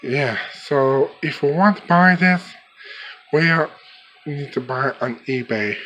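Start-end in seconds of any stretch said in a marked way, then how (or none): none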